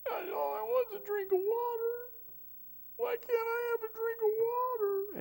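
high, whining voice; mock whine like a thirsty child; whiny Samson. Samson whining to God for water, high mock whine. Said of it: "and he said".

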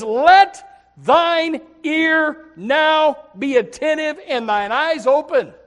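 very slow, loud, high scripture plea; preacher drawing out the verse, loud and slow; dramatic slow reading. Acted. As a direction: stretch each word out like a cry. Reads Nehemiah's plea dramatically.

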